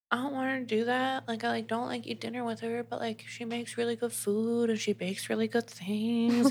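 softly acted reluctant thought; mock reluctance. Acting his reluctance to let her go.

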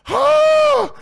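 very high, loud overjoyed shout; joyful yell. Real, not acted. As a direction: let out a burst of pure joy. Overwhelmed by fan support.

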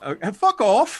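slow, high, loud mock Aussie; Australian accent. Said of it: "I love your accents".

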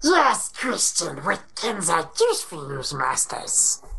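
slow, creepy Gollum-like servant voice. Retold or imitated. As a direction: grovel like Gollum, fading to breath on masters. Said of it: "make Siri sound like, uh, Gollum".

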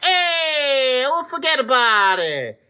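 high, drawn-out cartoon Italian; silly Italian caricature. Imagining an Italian grandmother, silly exaggerated Italian accent. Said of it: "it's just a silly caricature".

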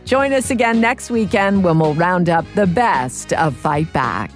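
ordinary delivery

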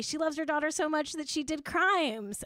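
usual speaking voice

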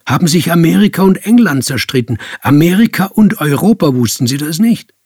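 loud, high, fast rant; worked-up harangue, pressing the listener hard; indignant tirade. Acted. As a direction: rattle off a heated history lecture. Heated lecture on war history.

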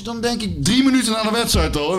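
ordinary delivery

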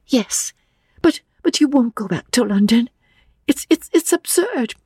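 put-on high, stammering young woman; nervous girl tripping over her words; young Lucy, flustered. A narrator's reading. Lucy protesting to old Mr Emerson, flustered and high.

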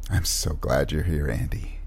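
low, soft murmur; quiet, intimate and low; tender sincerity. Acted. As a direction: say it gently, with heartfelt relief. Tender reunion with a friend.

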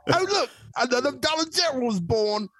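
high, slow mock British complaint; hammed-up British accent. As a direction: play a Brit lamenting the shops. Mocking British shoppers.

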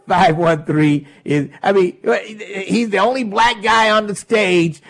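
normal talking voice

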